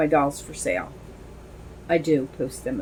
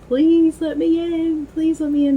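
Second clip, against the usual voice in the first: pleading little sister; 11 semitones higher, 4 dB louder than usual. Retold or imitated. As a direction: imitate a little kid begging, stretched and high. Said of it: "she used to sit at the door and say".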